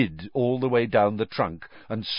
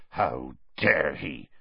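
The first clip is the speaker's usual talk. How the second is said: low, slow growl; low, seething growl; Hagrid; 6 semitones lower, 4 dB quieter, slower than usual. A narrator's reading. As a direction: growl it slowly with indignation. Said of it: "Hagrid growled".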